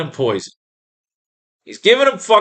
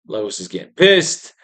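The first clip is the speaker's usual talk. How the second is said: ordinary talk, nothing marked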